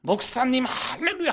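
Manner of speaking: acted high hallelujah cry; jubilant caller. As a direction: imitate an overjoyed caller bursting with good news. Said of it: "그 죽어간 아이가 살아났다라고".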